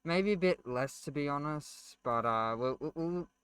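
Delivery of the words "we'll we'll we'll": stuck repeating the first word; stuttering. Real, not acted. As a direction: stumble on the opening word, stuttering. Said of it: "I'm stuttering a lot".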